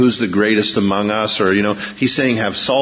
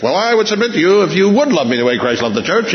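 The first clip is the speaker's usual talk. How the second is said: mock wife; 8 semitones higher, faster than usual. Wife voiced in marital quarrel.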